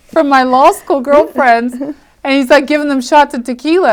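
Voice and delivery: amused disbelief spilling into laughter; laughing. Real, not acted. Telling how he collected her friends' numbers: laughing amusement.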